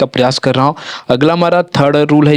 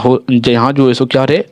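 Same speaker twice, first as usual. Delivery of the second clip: ordinary delivery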